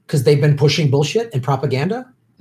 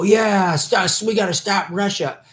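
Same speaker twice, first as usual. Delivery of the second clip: higher-pitched mock rallying cry; mock cheering along; 5 semitones higher than usual. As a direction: imitate people eagerly going along. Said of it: "for people to just go".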